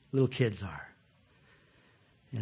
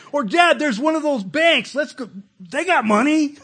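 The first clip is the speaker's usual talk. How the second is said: put-on high, loud eager child; excited kid; 9 semitones higher, 7 dB louder than usual. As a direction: pester Dad like a little kid. Kid voiced asking dad.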